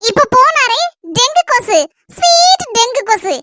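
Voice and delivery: slow, drawn-out mocking sing-song; wife mocks the rival, slow taunting. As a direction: sneer it out slowly. Mocking the husband's girlfriend.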